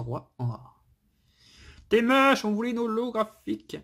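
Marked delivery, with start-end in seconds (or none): 1.9-3.8 mock complaint. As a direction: whine it high, in playful protest. Mock-insults the disappointing card. (fausse plainte aiguë)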